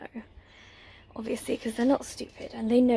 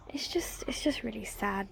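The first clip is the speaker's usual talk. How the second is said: sad whisper; 8 dB quieter than usual. Real, not acted. Sad about the empty hutch.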